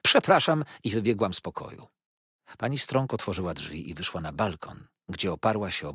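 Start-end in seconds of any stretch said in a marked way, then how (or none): 0.0-0.6 high sudden cry of apology; a mother bursting out before fleeing; cried out. A narrator's reading. Mother cries out to the lawyers, overcome. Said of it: "zawołałam".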